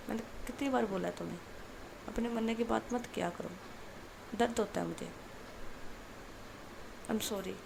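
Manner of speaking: soft, hushed apology right into her ear; whispering in ear. A narrator's reading. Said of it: "उसके कान में जाके बोलती है". (कान में धीमे से माफ़ी माँगना)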